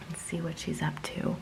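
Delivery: hushed whisper so the kitten sleeps; breathy whisper near a resting kitten. Real, not acted. Owner whispering beside the sleeping kitten, hushed.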